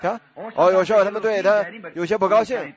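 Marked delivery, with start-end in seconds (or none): none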